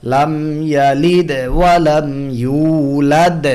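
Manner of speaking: ইয়ালিদ with a small echo, ইউলাদ with a big one; a small bounce on the middle দ, a full one on the last; small then big qalqala. Shown as a demonstration. Tajweed teacher modelling qalqala: small in ইয়ালিদ, big in ইউলাদ. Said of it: "ছোট কলকলা".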